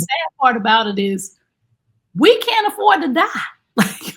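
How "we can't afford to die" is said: higher and louder, half laughing; emphatic punchline. Real, not acted. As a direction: land the line with a burst of emphasis. Punchline of a serious point.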